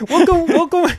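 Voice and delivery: high, loud auctioneer call; a lightly voiced auctioneer. Shown as a demonstration. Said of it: "an auctioneer".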